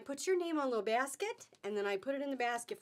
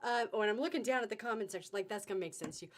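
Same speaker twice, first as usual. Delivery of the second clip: ordinary delivery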